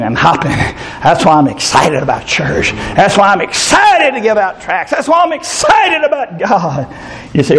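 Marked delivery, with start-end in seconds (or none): 3.0-6.9 rising preaching build. Acted. Preacher building to a shout about God.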